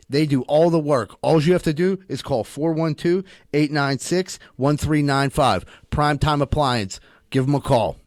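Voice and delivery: loud pitchman radio ad; ad read. Acted. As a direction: sell it like a local ad.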